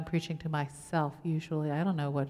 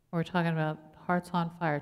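ordinary talk

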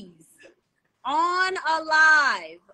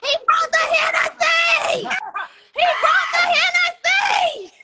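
much higher, loud, slow cheering shout; excited shout; 14 semitones higher, 7 dB louder, slower than usual. Real, not acted. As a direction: celebrate the bottle at the top of your voice. Excited about the Hennessy arriving.

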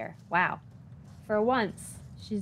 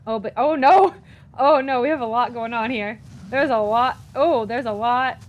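louder, frantic laughing panic; overwhelmed outburst; 2 semitones higher, 9 dB louder than usual. Real, not acted. Gamer swamped by enemies, loud and laughing in panic.

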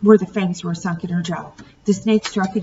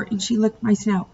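ordinary delivery, nothing marked

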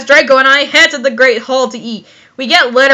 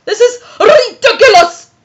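shouted disbelief; 6 semitones higher than usual. Shown as a demonstration. Repeats the shouted ridiculous, half laughing.

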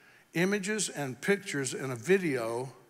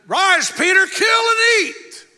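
booming high, slow shout as God; the Lord calls down to Peter in a mighty shout; 15 dB louder, slower than usual. Acted. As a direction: command it from heaven. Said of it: "the Lord spoke to Peter in a voice and said".

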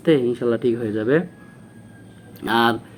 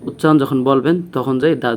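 ordinary talk, nothing marked

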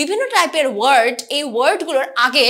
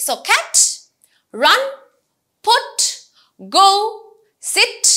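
each word called out high and louder, with pauses; example words called out; 7 semitones higher, 4 dB louder, much slower than usual. Shown as a demonstration. Teacher listing one-syllable example words, each called out high and louder. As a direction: ring out every example word on its own. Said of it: "এই সকল ওয়ার্ডসগুলোকেই আমরা বলছি free মরফিমস".

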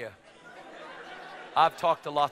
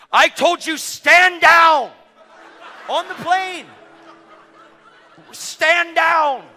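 high furious yell, much louder; screaming; 12 semitones higher, 14 dB louder, much slower than usual. Retold or imitated. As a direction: scream the order at him. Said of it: "Screaming at me".

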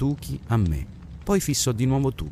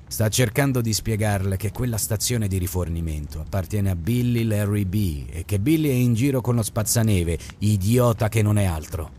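plain reading voice

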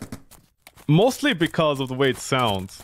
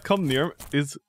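usual speaking voice

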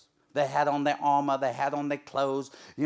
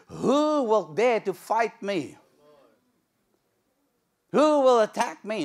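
higher, louder, slow booming challenge; Goliath's challenge; 7 semitones higher, 7 dB louder than usual. Acted. Goliath taunting the army of Israel, slow booming challenge. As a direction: roar it out like the giant. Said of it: "Goliath stands up and says".

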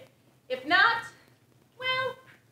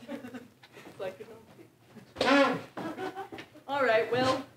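normal talking voice

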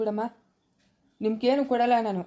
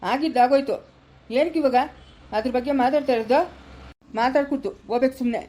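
loud, higher exasperated scold; angry scolding; 3 semitones higher, 4 dB louder than usual. Acted. Brother angrily tells Ambuji to drop the fight, loud scolding. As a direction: shut her up, fed up and loud. Scolding sister to stop arguing.

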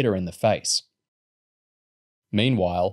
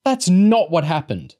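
slower, higher and louder protest; Lauren protesting to the vet, defensive; 9 semitones higher, 6 dB louder than usual. A narrator's reading. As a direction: push back, hurt and insistent. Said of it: "Lauren said defensively".

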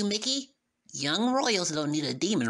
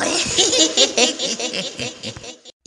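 loud, rising villain cackle; evil laugh; 10 semitones higher, 9 dB louder than usual. Acted. Demon laughing at the thought of revenge, loud evil cackle.

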